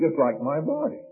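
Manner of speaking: usual speaking voice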